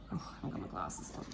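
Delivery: ordinary delivery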